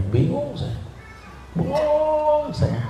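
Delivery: high, soft, drawn-out bingung saya; hammed-up helpless confusion; mock bewilderment. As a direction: play the confused ustaz. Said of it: "preacher acts his confusion".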